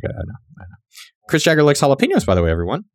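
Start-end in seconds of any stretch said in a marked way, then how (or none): 0.0-0.8 much softer, low tired murmur; weary murmur. Retold or imitated. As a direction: say it like you've heard it forever. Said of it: "It's like".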